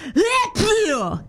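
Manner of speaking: imitating a wet sneeze; mock "bleh" sneeze noise. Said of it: "and she went".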